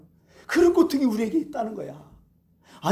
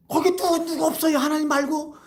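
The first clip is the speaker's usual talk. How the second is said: high, pitched-up call for anyone else; mock cry for help; 8 semitones higher than usual. Student hanging from a cliff calling for another rescuer, high mock cry. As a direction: yell out for someone other than God. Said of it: "학생이 소리 지르는 거예요".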